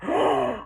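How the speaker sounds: sharp shocked gasp. Retold or imitated. Recalling her reaction to the ending, shocked gasp. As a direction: suck in air in shock. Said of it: "if I could keep gasping".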